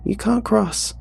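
put-on high little boy's voice, upset; a scared small boy pleads; crying child. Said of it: "he cried".

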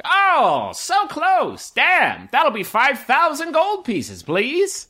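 high-pitched mock commiseration; enticing game vendor. Vendor demanding gold after the throw, high patter. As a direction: fake sympathy in a high hawker's voice, then ask for payment. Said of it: "one particularly enticing vendor who's".

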